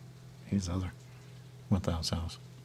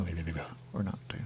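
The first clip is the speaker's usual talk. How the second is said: ordinary talk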